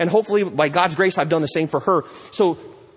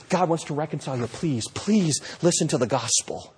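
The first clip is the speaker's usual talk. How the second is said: gentle pleading; 3 semitones lower, 6 dB quieter than usual. Pretend. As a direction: plead tenderly with the lost. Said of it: "You're pleading".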